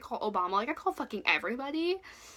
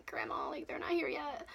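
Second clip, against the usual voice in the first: tearful whimper; 3 semitones higher, 8 dB quieter than usual. Retold or imitated. Calling grandma while crying.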